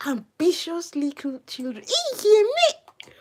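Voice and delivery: high, put-on scolding voice, the last words slow and whispered; mock scolding. Gamer mocking rival players as children, high scolding voice.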